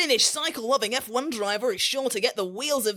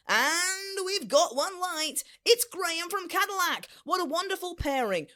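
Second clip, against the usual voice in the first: gameshow announcement; 7 semitones higher than usual. Acted. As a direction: announce the winner like a dating show host. Host announces the match.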